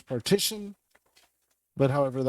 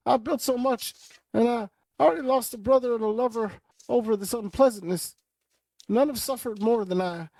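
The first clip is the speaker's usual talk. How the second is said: high, pleading voice of a man begging; pleading villain; 8 semitones higher than usual. Acted. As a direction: beg for mercy, high and frightened. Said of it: "he says".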